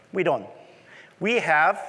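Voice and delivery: ordinary talk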